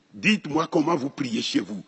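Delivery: ordinary delivery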